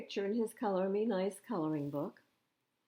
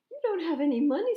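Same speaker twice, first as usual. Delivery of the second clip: fast, high and louder objection; high boy voice; 11 semitones higher, 8 dB louder, faster than usual. A narrator's reading. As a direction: blurt it out like a protesting boy. Arthur objecting in story.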